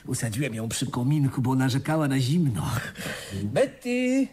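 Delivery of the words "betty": calling to a friend who does not answer; alarmed call. Acted. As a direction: call her name out, higher and urgent.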